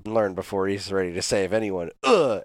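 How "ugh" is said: frustrated groan, pitched up; a loud exasperated ugh; annoyed ugh. Retold or imitated. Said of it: "they both always do the, "Ugh" sound effect".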